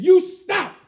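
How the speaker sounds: loud, high commanding yell; furious command to stop; angry shout. Retold or imitated. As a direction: bark it at fleeing boys. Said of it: "and I said".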